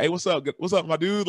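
imitating Bush's easygoing hello to the young guest; laid-back President Bush. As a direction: toss off a relaxed greeting like an easygoing president. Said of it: "he was so laid back, so chill".

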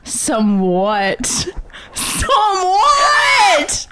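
playful, over-the-top incredulous shriek; mock outraged shout. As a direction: escalate into a stretched, high-pitched holler. Exaggerated repeated question escalating to yell.

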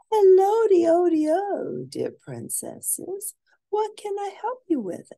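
put-on high, sing-song greeting from a fussy old librarian; kindly librarian voice. Librarian greeting the princesses, high sing-song voice. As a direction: chirp it out like a cheerful librarian.

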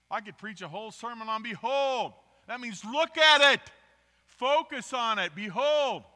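loud, high preaching shout. Acted. Preacher building to a loud, high shout over the word behold. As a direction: build it up and hammer the word home.

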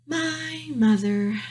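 slow, drawn-out sorrowful princess; sad. A narrator's reading. Princess remembers her mother, slow and sad. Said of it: "the pea said sadly".

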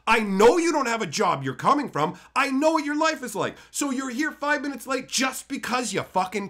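chewing out a chronic latecomer; retold angry rant. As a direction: build the scolding to an exasperated peak. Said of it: "When I yell at people".

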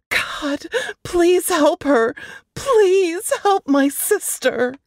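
slow, high, pleading prayer; desperate prayer. Acted. Sister praying for her missing sister, slow and pleading. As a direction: beg God slowly for help.

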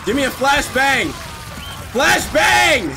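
escalating shout. Real, not acted. Gamer urgently demanding a flashbang from Ashley, shouting.

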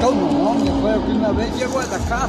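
loud, fast and eager, calling out. Real, not acted. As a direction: speak up loudly to a visitor. Old man greets visitor loudly.

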